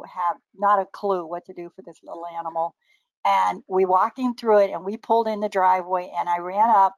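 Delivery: normal talking voice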